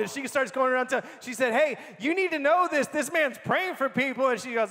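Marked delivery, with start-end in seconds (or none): 1.5-4.3 high, eager announcement; excited testifying. Retold or imitated. Healed woman telling other shoppers about the praying man, excited. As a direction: imitate her spreading the news.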